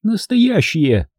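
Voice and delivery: high, slow woman's retort; tossed off. A narrator's reading. Hilda insists her name is real, tossed-off high retort. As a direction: toss back one word, pitched high. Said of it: "бросила она".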